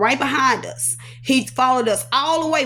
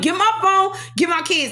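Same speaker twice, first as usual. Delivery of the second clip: high, hurried plea; retold urgent demand; 7 semitones higher than usual. Patient begging staff for children and phone, retold urgent demand. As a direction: press staff urgently, pitched up.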